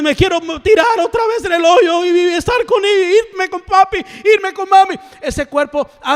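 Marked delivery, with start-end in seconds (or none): 0.0-5.0 hammed-up mourner pleading to join mami, raised; mock grieving mourner. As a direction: imitate a relative begging at the grave, higher. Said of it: "la gente volviendo".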